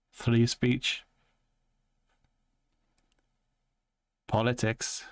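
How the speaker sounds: imitation of another man's twang; lightly imitated twangy accent. Shown as a demonstration. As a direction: talk with a put-on twang, feeling out the inflections. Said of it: "like, a little twang in his voice".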